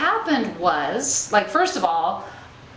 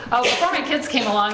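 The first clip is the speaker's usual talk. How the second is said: normal talking voice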